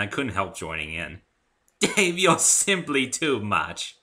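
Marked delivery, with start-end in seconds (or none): 1.8-4.0 high, loud slow refined pony; grand fond Rarity; theatrical lady unicorn. A narrator's reading. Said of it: "said Rarity".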